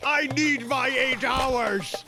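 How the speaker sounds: ordinary talk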